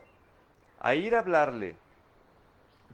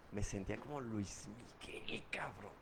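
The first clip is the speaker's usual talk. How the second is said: hushed whisper, much softer; 6 semitones lower, 11 dB quieter than usual. Real, not acted. Telling the group how alcohol made him feel, whispered. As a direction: let it out like a confession, barely voiced. Confides how good he felt.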